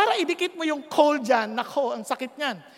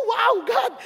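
surprised exclamation; 8 semitones higher, 4 dB louder than usual. Retold or imitated. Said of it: "Sabi ko".

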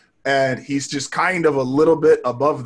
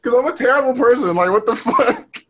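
laughing; 10 semitones higher, 4 dB louder than usual. Real, not acted. Laughing at own remark.

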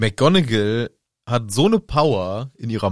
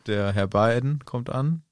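ordinary delivery

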